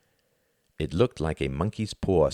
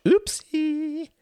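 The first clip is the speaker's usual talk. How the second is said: put-on little girl voice; 3 dB louder than usual. A narrator's reading.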